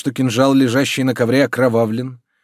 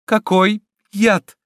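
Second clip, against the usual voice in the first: high, drawn-out disbelief; stretched, high cry of alarm; alarmed question; 12 semitones higher, 5 dB louder, much slower than usual. A narrator's reading. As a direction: ask slowly in dread, pitch raised. Heroine alarmed by news of poison.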